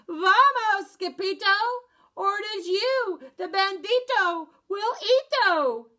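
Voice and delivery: high, loud panicked shouting; frantic cry. Acted. Chimichangos shouting to Scipito to flee, loud and frantic. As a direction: yell the warning in a panic. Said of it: "they cried".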